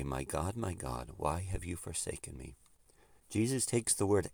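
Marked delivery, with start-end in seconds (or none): 0.0-2.6 hushed, forsaken plea, much softer; quiet anguish. Retold or imitated. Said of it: "Which is to say".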